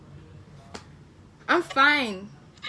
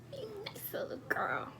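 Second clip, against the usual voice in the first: higher, softer whisper; whispered coo; 7 semitones higher, 14 dB quieter than usual. Acted. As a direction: breathe it out as a whisper. Repeats the compliment in a whisper.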